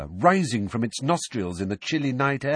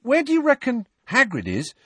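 higher, keen and urgent; eager question; 14 semitones higher, 4 dB louder than usual. A narrator's reading. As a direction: ask Ron eagerly about Hagrid. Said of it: "eager to consult Ron about Hagrid".

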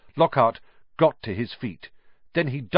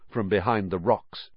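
usual speaking voice

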